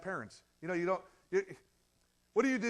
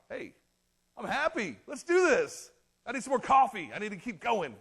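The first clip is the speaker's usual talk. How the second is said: bright, loud put-on cheer, pitched higher; mock upbeat pep; fake cheerful; 5 semitones higher, 7 dB louder than usual. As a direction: sell the happy-churchgoer act. Mock cheerful parking lot face.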